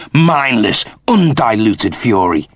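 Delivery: plain reading voice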